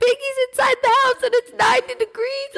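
high, tearful wail through laughter; mock crying. Crying on the curb outside locked house, high mock-tearful protest. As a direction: wail it as a hammed-up crybaby. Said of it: "I'm on the curb, like, crying".

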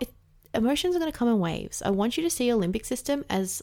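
ordinary talk, nothing marked

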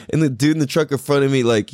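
ordinary delivery

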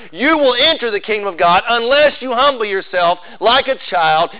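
high, insistent pulpit delivery; emphatic preaching. Acted. Preacher warning the congregation there is no other way, emphatic preaching. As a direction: hammer every negative home, relentlessly.

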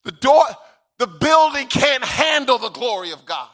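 loud, high-pitched shouted sermon; full-force preaching. Acted. Preacher shouting angels' worship in the temple, full-force preaching. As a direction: preach it at the top of your voice, drawing out the big words.

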